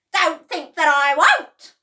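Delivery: high, very loud threat; defiant Grandma. A narrator's reading. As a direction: dare them to stop her. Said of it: "Grandma said".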